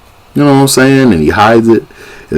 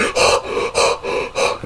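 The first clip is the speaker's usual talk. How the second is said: heavy, breathy gasps in mimicry; imitated hard breathing; 11 dB quieter than usual. Man in torture scene breathing hard, imitated gasps. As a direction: pant hard like the man in pain. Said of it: "he was breathing hard".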